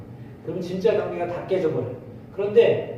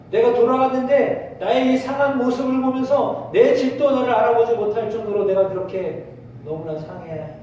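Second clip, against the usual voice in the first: ordinary talk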